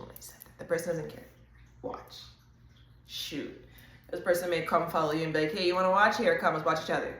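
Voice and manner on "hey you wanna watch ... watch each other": mock loud invite. Admirer calls out to you.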